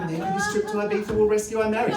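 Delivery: hope against hope, lightly voiced; mock hopeful Harold; hopeful husband. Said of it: "He thought".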